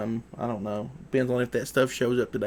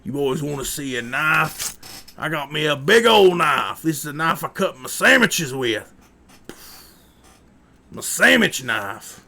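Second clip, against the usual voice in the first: hammed-up creepy hillbilly, louder and higher; 4 semitones higher, 8 dB louder than usual. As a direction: play the odd backwoods guy luring the boys in. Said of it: "I'll just talk like this and be like".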